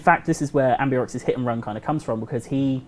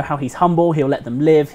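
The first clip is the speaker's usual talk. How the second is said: normal talking voice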